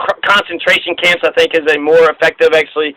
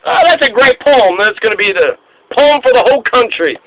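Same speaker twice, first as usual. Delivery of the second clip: higher, delighted mock voice; mocking Woodrow Wilson; 6 semitones higher than usual. Host mocking Wilson praising Key's poem, high and gushing. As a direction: gush like a pleased president, pitched up. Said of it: "Woodrow Wilson's like".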